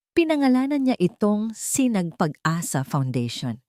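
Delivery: ordinary delivery, nothing marked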